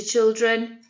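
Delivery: ordinary talk, nothing marked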